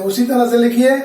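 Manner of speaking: ordinary talk, nothing marked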